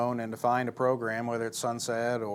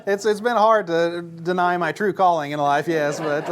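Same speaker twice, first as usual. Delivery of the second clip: higher, louder hammed-up woe; mock lament; 7 semitones higher, 8 dB louder than usual. Laughing about his lost wrestling career, mock tragic. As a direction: play the lost calling as a tragic joke.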